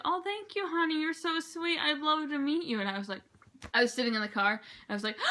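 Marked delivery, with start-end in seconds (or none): 0.0-2.8 high, sweet gushing celebrity voice; a lightly put-on sweet actress; imitating Jane Leeves. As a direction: play the star being gracious to a fan, much higher. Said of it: "She's like".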